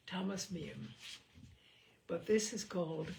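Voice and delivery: plain reading voice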